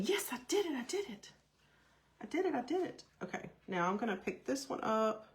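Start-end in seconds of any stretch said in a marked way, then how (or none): 0.0-3.0 high, thrilled little cheer of victory; excited triumph. Real, not acted. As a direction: celebrate getting it right, repeating it gleefully. Triumph after perfect stamping.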